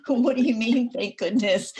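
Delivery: a listener caught off guard by the joke; startled question. Retold or imitated. Audience member thrown by the poet's aside, asking in surprise. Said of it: "there's always a little nervous laughter".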